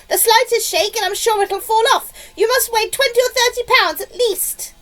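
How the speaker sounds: high, loud nagging; scolding yell. A narrator's reading. Aunt warns boy he'll shake the peach down, high yell. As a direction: keep scolding at the top of the pitch. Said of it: "Aunt Spiker yelled".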